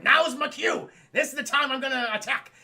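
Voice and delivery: high, loud mock-heroic call; eager battle cry. Batman seizing his chance to attack, eager battle cry. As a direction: declare the attack with hammed-up gusto.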